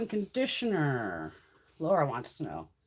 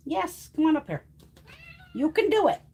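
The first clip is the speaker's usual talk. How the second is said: coaxing cat; 9 semitones higher, 5 dB louder than usual. Real, not acted.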